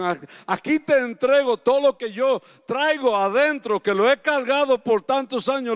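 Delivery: loud, high, climbing prayer of surrender; fervent prayer. Acted. Preacher voicing a believer surrendering to God, loud rising prayer. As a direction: cry it out to God, rising with each line. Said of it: "esas son las que Dios quiere que tú voluntariamente digas".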